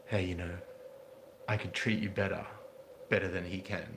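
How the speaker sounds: low, soft seductive murmur; sleazy whisper in ear. Retold or imitated. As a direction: lean in and purr it low. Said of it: "little words in her ear like".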